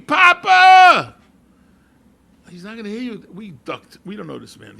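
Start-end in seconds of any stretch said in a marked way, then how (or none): 0.0-1.1 full-throated high scream across the tarmac. Retold or imitated. Father on the observation deck screaming to his arriving dad. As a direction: yell over the roar of propellers. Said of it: "He's screaming".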